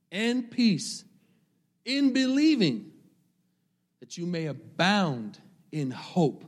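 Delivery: slow, swelling scripture reading; preaching a verse. Acted. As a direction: lift each phrase of the verse.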